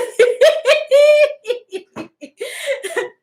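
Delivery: high squealing laugh; helpless high laughter; high laughing fit. Real, not acted. Big laugh at joke.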